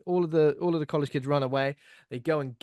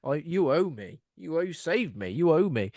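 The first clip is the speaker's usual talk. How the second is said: mock entitled insistence, a bit raised; entitled dude; 4 semitones higher than usual. Chad claiming Allison after saving her, mock entitled demand. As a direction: press the claim like a self-important douche. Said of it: "Entitled dude. What a douche".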